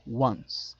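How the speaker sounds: "Once" repeated alone as English "wuns"; pronunciation model. Shown as a demonstration. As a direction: model "Once" clearly with an opening w. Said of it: "me encantaría que la pronunciaran".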